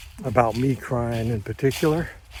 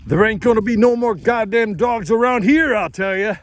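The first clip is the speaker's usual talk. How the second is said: much higher and louder, hollering; drunken yell; 11 semitones higher, 6 dB louder than usual. Retold or imitated. Drunk father storming out with shotgun, yelling. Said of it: "yelling something about".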